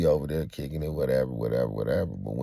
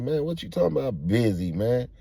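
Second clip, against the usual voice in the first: higher-pitched protest; puzzled pushback, pitched up; indignant questioning; 8 semitones higher than usual. Retold or imitated. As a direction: challenge the excuse in disbelief. Acting out his protest.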